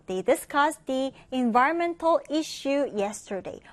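very slow careful English reading; slow model English sentence. Shown as a demonstration. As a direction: read slowly for learners. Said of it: "reading model sentence". (아주 천천히 영어 예문)

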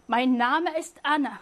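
Mein Name ist Anna read slowly and clearly; slow German reading. Shown as a demonstration. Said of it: "이런 문장인데요".

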